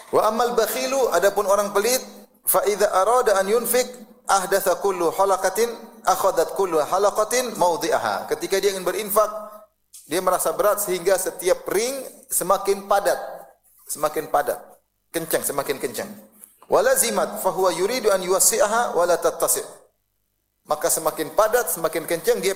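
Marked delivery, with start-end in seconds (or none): 0.1-1.1 raised, measured recitation of scripture; chanted Arabic hadith reading. Acted. 2.5-8.2 raised, measured recitation of scripture; chanted Arabic hadith reading. Acted. 16.7-19.8 raised, measured recitation of scripture; chanted Arabic hadith reading. Acted.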